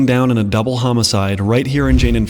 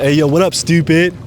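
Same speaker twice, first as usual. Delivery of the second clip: put-on Baltimore accent; 5 semitones higher, 4 dB louder than usual. Greeting a friend in Baltimore, put-on Baltimore accent. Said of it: "In Baltimore, if someone's your friend".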